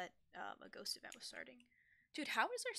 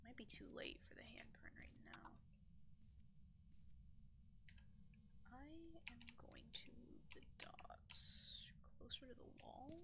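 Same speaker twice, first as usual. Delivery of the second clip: whispering; 2 semitones higher, 8 dB quieter than usual. Real, not acted. Whispering in haunted room.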